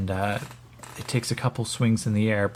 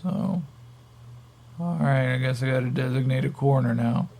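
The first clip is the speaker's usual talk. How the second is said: resign to it like a spaced-out stoner; stoned captive; 3 semitones higher than usual. Acted. Stoned captive accepts the corner.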